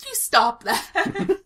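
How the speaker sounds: high, loud, slow mimicry; mock the drawn-out cry, loudly; laughing high-pitched imitation; mimicking the outburst.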